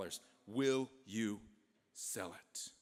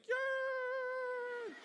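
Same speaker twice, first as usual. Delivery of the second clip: very high, held cry; ecstatic squeal. Acted. Jefferson walking around all day, stunned gleeful squeal. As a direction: squeal one long frozen note of glee. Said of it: "exactly what his face looked like".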